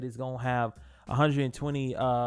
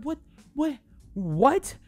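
baffled what; 11 semitones higher, 4 dB louder, much slower than usual. Real, not acted. Baffled at the dating quest.

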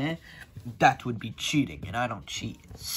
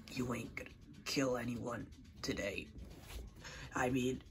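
normal talking voice

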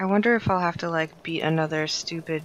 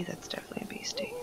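fast, unvoiced whisper; 13 dB quieter, faster than usual. Real, not acted. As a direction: hush so it won't notice. Spots a creature.

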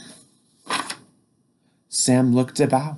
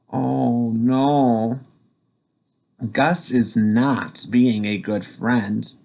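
plain reading voice

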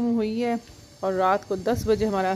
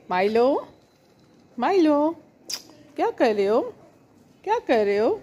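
raised, higher calling voice; calling the cat; 7 semitones higher, 5 dB louder than usual. Real, not acted. Woman calling her cat Milo on the roof, loud and high. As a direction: call out to the cat across the roof.

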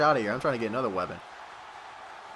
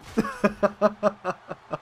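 long, higher-pitched cackle; gloating laugh; 5 semitones higher than usual. Real, not acted. Player laughing over the beaten opponent, gloating. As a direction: laugh on in triumph.

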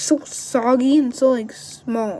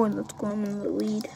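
whisper; 3 semitones lower, 11 dB quieter than usual. Real, not acted. Whispered gloat.

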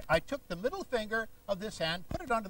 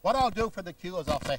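ordinary talk

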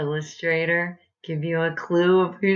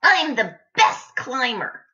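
little lion voice; 7 semitones higher than usual. A narrator's reading. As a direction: boast like a little lion cub. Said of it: "said little lion".

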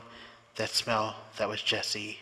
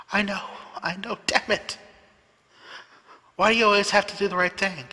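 high, fast, frustrated cry; exasperated outburst; 9 semitones higher, 5 dB louder than usual. Acted. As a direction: burst out in loving exasperation. Exasperated character outburst in dialogue.

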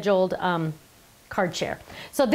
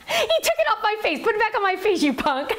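high-pitched, giggly protest; laughing; 10 semitones higher than usual. Real, not acted. Laughing at the camera operator mid-story, high giggly voice. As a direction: crack up while scolding playfully.

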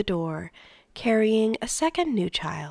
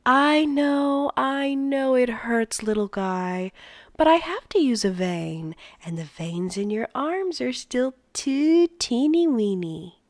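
put-on special baby-talk voice; 5 semitones higher than usual. A narrator's reading. Father to newborn getting a shot, special baby-talk voice. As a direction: soothe a baby with exaggerated baby talk. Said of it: "Still in the special voice".

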